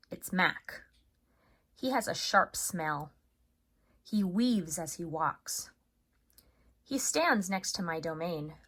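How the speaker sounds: measured storytelling with long pauses; teacher reading a chapter book to third graders; read-aloud narration. A narrator's reading. Teacher reads the novel aloud.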